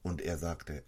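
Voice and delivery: ordinary talk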